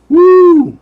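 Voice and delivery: high loud woo; a high hooting woo; woo whoop. Retold or imitated. Exclaiming mid-lecture.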